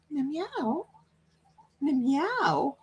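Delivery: high, soft mewing of a judgy cat; soft, high imitated cat meow. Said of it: "All judgy?".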